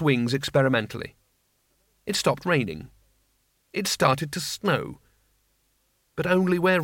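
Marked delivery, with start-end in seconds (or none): none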